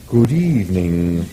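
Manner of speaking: low slow bovine voice; a polite cow greeting diners; talking cow. Acted. The dish of the day greets diners in a low slow voice. The animal's voice.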